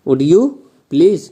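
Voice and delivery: slow, polite request with rising end; teacher models a gentle, drawn-out request. Shown as a demonstration. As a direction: ask slowly and politely, lifting at the end. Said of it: "तो आप ऐसे बोलते हैं".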